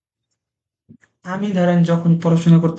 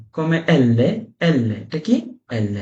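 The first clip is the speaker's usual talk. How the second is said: slow, careful teacher demo of each L sound; drilling a beginner one syllable at a time; 3 semitones lower, much slower than usual. Shown as a demonstration. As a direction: spell out each Italian syllable very slowly. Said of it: "come L, teaching syllable sounds".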